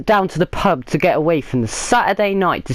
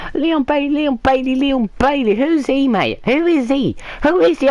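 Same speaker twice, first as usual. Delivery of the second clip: higher, scornful calling of the name; mocking disbelief; 7 semitones higher than usual. Real, not acted. Fan mocking the winger he rates a clown, higher and scornful. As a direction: sneer at him like a stranger.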